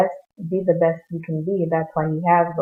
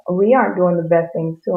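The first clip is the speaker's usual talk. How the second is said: ordinary talk, nothing marked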